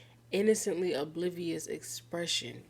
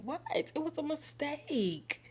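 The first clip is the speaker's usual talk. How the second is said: defensive whine; 8 semitones higher than usual. Real, not acted. Defending herself to the cat.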